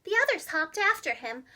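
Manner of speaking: ordinary delivery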